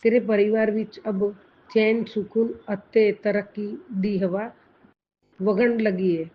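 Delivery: a devotee reads out the Guru's message, proclaiming; reading a blessing message. Acted. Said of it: "वो पंजाबी में".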